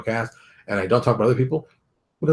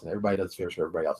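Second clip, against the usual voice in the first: quick aside; 4 semitones lower, 8 dB quieter, much faster than usual. Shown as a demonstration. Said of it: "repeated as aside".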